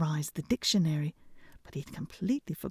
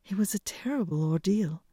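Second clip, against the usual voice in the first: ordinary delivery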